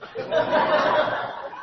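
a short airy laugh; breathy laugh. Real, not acted. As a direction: laugh at the memory. Laughing at his own story.

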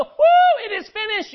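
high, whooping celebration; mock triumph. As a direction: cheer it out in a high, loud whoop. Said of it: "he doesn't go".

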